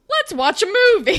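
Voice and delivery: high, loud breezy dodge; evasive. Retold or imitated. Boyfriend dodging his girlfriend's question, loud breezy evasion. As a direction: brush the question off brightly.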